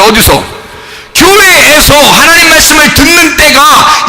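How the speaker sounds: high, loud, impassioned sermon delivery; rising pulpit fervor; fiery preaching. Acted. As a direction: preach it full force to the congregation. Preaching at full force.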